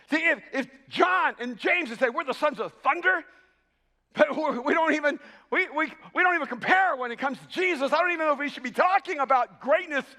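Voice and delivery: emphatic pulpit exclamation; fervent preaching. Acted. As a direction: preach it with rising insistence. Preaching rises in force.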